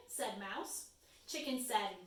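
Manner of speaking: ordinary delivery, nothing marked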